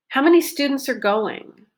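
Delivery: are said as a weak er after students; reduced are. Shown as a demonstration. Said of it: "What did I reduce?".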